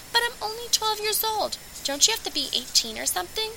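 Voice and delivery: lightly voiced tearful girl; young girl. Chelsea asks Lane about the pageant age limit, in a high girlish voice.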